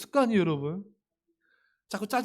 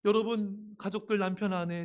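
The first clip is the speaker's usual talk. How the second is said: ordinary delivery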